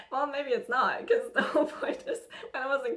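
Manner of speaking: breaking into real laughter mid-sentence; real laugh spilling into the words. Real, not acted. Laughs at herself mid-story.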